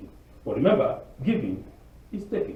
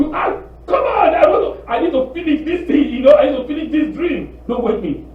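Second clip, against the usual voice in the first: high, loud protest at being woken from a dream; annoyed at being woken; 10 semitones higher, 6 dB louder than usual. Retold or imitated. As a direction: whine loudly, don't let them wake you. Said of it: "I hate when people wake me up".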